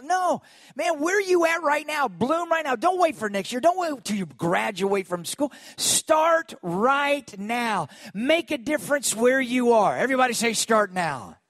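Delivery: rally the church to start now; fired-up preaching. Acted. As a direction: preach it, pushing them to act now. Preacher urging congregation to act now.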